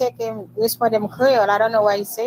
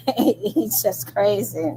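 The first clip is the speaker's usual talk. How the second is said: laughing; much slower than usual. Real, not acted. Laughing off a viewer's comment.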